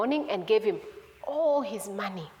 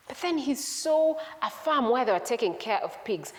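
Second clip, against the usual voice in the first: usual speaking voice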